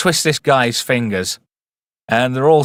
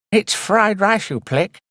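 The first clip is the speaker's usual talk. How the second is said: high, slow mock Chinese accent, scolding; hammed-up Chinese accent correcting a mistake; 10 semitones higher, slower than usual. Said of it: "says Ash and Prism".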